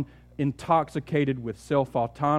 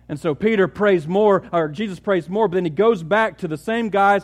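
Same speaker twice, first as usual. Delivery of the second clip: louder, rising preaching; building sermon intensity; 6 semitones higher, 7 dB louder than usual. Acted. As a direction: build the story toward the climax.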